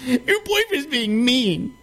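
put-on high, whining little boy; mock a spoiled boy begging his mom; high childish whine, mocking; whiny kid voice. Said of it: "He's just a little bitch boy".

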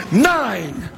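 emphatic call. Acted. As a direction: hit it hard and let it fall. Celebrated count of winning passes.

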